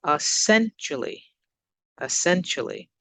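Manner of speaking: essentially with SEN punched; careful teacher's demo of es-SEN-tially; stressed syllable demo. Shown as a demonstration. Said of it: "let's look at where we stress the word".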